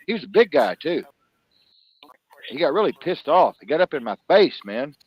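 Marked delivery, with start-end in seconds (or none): none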